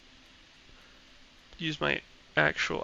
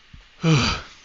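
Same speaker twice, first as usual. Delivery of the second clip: loud sigh; 2 semitones higher, 10 dB louder than usual. Real, not acted.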